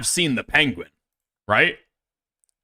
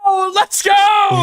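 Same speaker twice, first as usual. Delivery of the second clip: high, stretched cheer; excited shout; 7 dB louder, much slower than usual. Real, not acted. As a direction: yell it out like a hype man. Cheering a named example.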